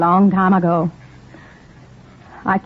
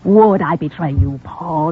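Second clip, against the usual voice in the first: normal talking voice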